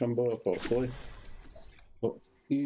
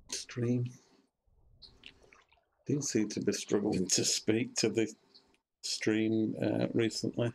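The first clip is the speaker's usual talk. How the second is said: sleepy, slurred mumble; drowsy. Real, not acted. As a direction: speak as if on painkillers. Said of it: "talk a little bit drowsy".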